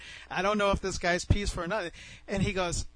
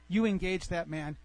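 ordinary delivery

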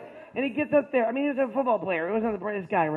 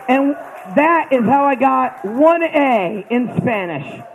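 much louder, slowed triumphant payoff; big finish; 2 semitones higher, 10 dB louder, slower than usual. Real, not acted. Comedian delivering the punchline to the crowd, loud triumphant. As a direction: land the punchline loud and slow.

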